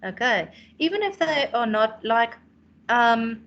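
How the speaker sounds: ordinary talk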